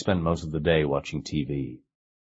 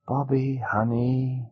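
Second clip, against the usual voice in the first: mother's voice; 8 semitones higher, slower than usual. A narrator's reading. Mother softly calls her son.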